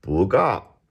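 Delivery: "不" rising, "高" falling from high; second then fourth tone. Shown as a demonstration. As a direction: lift "不", then drop "高". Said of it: "changing the first one to a second".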